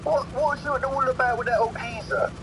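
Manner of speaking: high-pitched mock youth voice; slang-talking youth. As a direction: act a curious young mate asking. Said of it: "hit someone with a rolled up newspaper".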